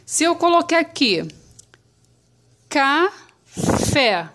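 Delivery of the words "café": "café" broken as ca, pause, fé; syllable by syllable. Shown as a demonstration. Teacher writing an example, sounding it out by syllables. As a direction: hold each half of "café" apart. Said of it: "se eu for separar a palavrinha café".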